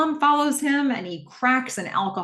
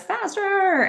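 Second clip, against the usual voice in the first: single word pitched way up and pushed; menacing demand; 14 semitones higher, 4 dB louder than usual. Retold or imitated. Kidnapper urging Tom to drive faster, high pushed demand. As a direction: snap it out high and urgent.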